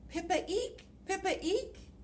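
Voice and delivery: high squeaky mouse; mouse squeak. Acted. Teacher voicing Mouse for children, high squeak.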